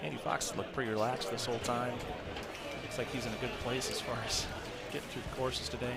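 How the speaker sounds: golf commentator. Acted. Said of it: "Don't use the golf commentator voice".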